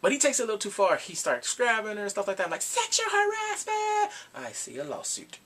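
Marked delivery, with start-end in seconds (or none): 2.6-4.1 high, drawn-out cry; hammed-up scandalized squeal; mock alarm. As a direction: call it out slowly like a siren. Crying out reaction to groping.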